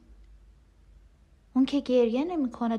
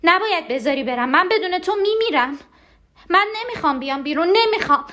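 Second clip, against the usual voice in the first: rising, high-pitched panicked refusal; desperate protest; 5 semitones higher than usual. Acted. Begs not to leave. (التماس و اعتراض هراسان)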